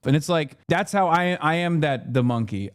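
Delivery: ordinary talk, nothing marked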